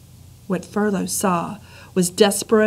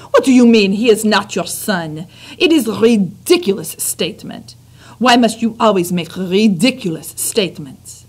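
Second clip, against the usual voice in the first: put-on French accent; 5 dB louder than usual. French mother scolds husband.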